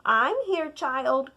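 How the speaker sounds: plain reading voice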